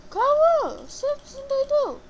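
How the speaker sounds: high, loud disbelieving yell; excited shout. Real, not acted. Teammate does something crazy.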